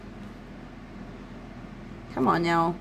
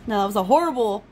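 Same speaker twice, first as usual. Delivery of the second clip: ordinary delivery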